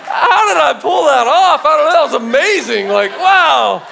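loud, high-pitched hammed-up amazement; amazed self-congratulation. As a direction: marvel loudly at your own feat. Father's mock self-congratulation, carried on.

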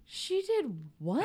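high, drawn-out mock disbelief; retold disbelief. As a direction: act out shocked confusion at the news. Acting out shock at the email.

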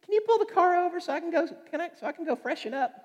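imitating mom. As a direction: play your mom asking to pull over, light and fast. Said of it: "she'd say, like".

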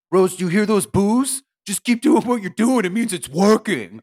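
mocking the coach's bad advice between rounds. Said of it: "He's not doing well".

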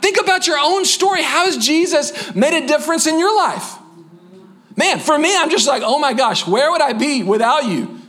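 full-force preaching, pitched high and driving; fervent preaching. Acted. Pastor urging the congregation to share Jesus, fervent high preaching. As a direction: preach it like a rally cry to the congregation.

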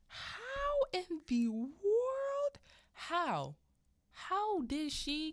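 very high, drawn-out incredulous cry; loud disbelief. Real, not acted. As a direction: stretch it out loud in utter bafflement. Host's own exaggerated disbelief.

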